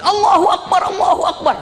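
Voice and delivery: imitating a crowd's admiring cry, very high; high mock-jubilant acclamation; awestruck takbir. Whole mosque answers the convert's tale, awestruck. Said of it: "kagum semuanya".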